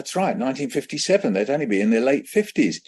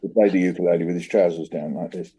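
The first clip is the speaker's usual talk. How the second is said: slurred mumble; 6 semitones lower than usual. Retold or imitated. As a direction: mumble the poem half-asleep. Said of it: "my speech was so slurred".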